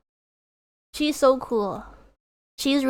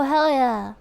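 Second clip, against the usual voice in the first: ordinary delivery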